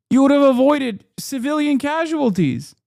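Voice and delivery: high, loud insistent point; heated outcry. Real, not acted. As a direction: drive the point home, heated. Ranting at Israel's choices.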